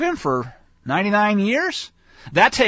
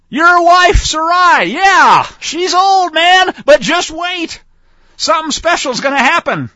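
high, loud, joyful proclaiming; excited shout; 9 semitones higher, 8 dB louder, slower than usual. Acted. God shouting the promise to Abraham, overjoyed. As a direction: shout the good news like it can't wait.

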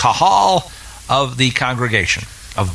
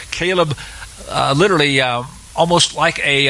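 ordinary talk, nothing marked

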